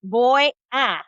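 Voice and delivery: slow, careful Spanish; slow model phrase. Shown as a demonstration. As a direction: say each word slowly. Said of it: "you're gonna say".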